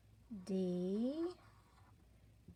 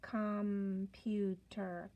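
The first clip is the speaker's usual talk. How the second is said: "computer" stretched out slowly, syllable by syllable; slow blending; 3 semitones lower, much slower than usual. Shown as a demonstration.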